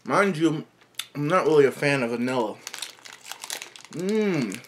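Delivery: chewing while talking; speaking between bites; mouth full. Real, not acted. Seen 0: Mouth moves with closed-lip chewing and puffed cheeks while speaking; no bar shown.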